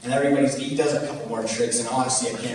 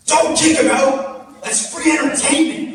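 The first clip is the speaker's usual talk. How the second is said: high, loud yelled plea, slow start; mock scream; 7 dB louder, slower than usual. Balcony guy shouting at security, high loud yell. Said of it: "you can hear him scream".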